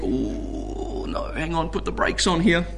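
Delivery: hushed, breathy mock alarm, nearly whispered. Preacher voicing a worried listener, hushed mock alarm. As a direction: play a nervous listener muttering under breath.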